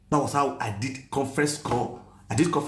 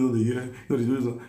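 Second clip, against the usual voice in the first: usual speaking voice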